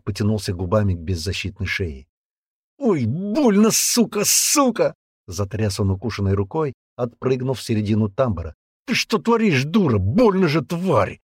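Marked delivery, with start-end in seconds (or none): 2.8-4.9 high yelp of pain. Acted. Bitten man cursing the girl, pained high yelp. 8.9-11.2 high, pained outrage; pained anger. Acted. Bitten man to the girl, pained high outrage.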